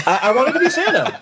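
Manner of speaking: high, laughing insistence; squeaky earnest protest through laughter; insistent confession. Real, not acted. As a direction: repeat it emphatically, voice shooting up. Repeats confession emphatically after reaction.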